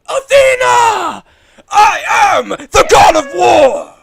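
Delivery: huge, slow, high battle bellow; God of War roar. Pretend.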